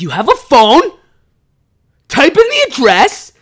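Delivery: shrieking high and loud at her, furious; angry yell. Retold or imitated. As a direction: yell it down the phone in a rage. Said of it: "Like, I was so angry".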